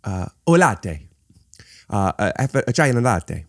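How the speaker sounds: halting mock Italian accent, full of uhs. Shown as a demonstration. Guest struggles through his coffee order, halting Italian accent. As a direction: hunt for the word as a put-on Italian. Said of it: "I can do an Italian accent".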